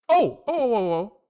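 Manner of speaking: sudden burst of high, loud oh's; excited oh oh oh. Real, not acted. Coder reacting as the broken code finally does something, excited yelps. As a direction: yelp as the screen finally changes.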